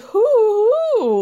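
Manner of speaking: loud, high mock hooting; imitating Sheree's hoots. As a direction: hoot like Sheree in the gif. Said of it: "like Sheree in that gif".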